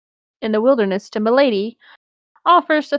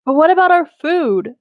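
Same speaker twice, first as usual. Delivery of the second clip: whiny complaint; 6 semitones higher, 5 dB louder than usual. Acted. Sam whining about food.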